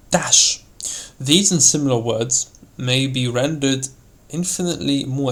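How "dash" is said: DASH flung out sharply; a sudden, expressive DASH; word-painting. Shown as a demonstration. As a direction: make DASH sound like its meaning. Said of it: "bring out the meaning of the word by its sound".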